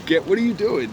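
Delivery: amused mock scolding through laughter; laughing, incredulous teasing; retold dying laughing. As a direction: act out cracking up at someone. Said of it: "then started dying laughing".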